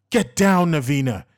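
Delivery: loud, sharp order; forceful command. A narrator's reading. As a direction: bark it to keep her alive. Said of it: "he said forcefully".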